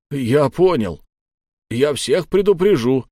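frightened nodding. A narrator's reading. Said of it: "закивал, как болванчик".

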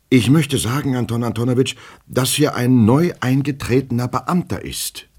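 high, anxious clerk voice; nervous, high-pitched explanation; agitated petty clerk. Acted. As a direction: plead urgently to make him understand. Agitated clerk explains to his superior.

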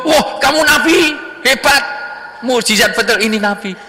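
hammed-up wonder, pitched high; amazed. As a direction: gush with awe at the miracle. Young man marvels at miracle.

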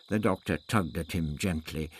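ordinary delivery

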